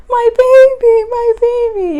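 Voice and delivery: put-on high, loud, slow my baby; mother greeting her newborn; overjoyed cry. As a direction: cry it out in joy. First holding newborn.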